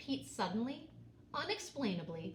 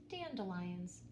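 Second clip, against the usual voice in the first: ordinary delivery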